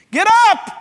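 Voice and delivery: sudden shouted command; sharp shout of get up. Acted. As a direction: yell it at the corpse to rise. Preacher shouts at dead man.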